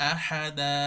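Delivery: আহাদা ending on a long আ with no nun; pausal ending. Shown as a demonstration. Teacher shows waqf on আহাদা. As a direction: stop on আহাদা, stretch the last দা. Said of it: "দান না পড়ে দা".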